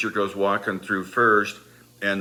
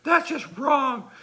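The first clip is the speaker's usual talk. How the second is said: high, aggrieved wail; hammed-up indignant complaint; mock pained outrage; slower than usual. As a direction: imitate a sore friend protesting. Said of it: "his rear was on fire".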